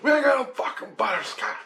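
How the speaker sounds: high, strained mock yell; hammed-up exasperated outburst; imitated angry refusal. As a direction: play the irate vendor, pitch shooting up. Angry ice-cream man refuses again.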